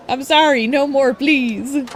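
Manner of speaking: begging for mercy. Acted. Beaten thug begging the hero to stop, high pleading.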